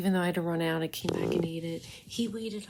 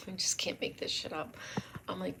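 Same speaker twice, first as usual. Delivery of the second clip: normal talking voice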